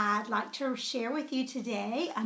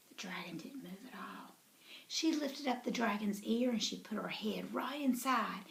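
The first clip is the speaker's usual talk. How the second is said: hushed narration whisper; 3 semitones lower, 8 dB quieter than usual. A narrator's reading. Narrator whispering to the children. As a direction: tell it in a whisper. Quiet story suspense.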